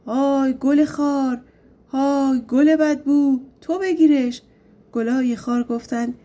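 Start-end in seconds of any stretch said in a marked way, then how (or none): 0.1-4.4 shouting. A narrator's reading. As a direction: draw out the demon's shouted order. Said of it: "داد زد".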